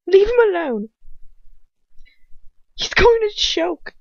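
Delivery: high, loud, desperate protest; scream. A narrator's reading. Hermione to the captors as Ron chokes, screaming. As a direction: scream it out in alarm for a friend. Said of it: "I scream".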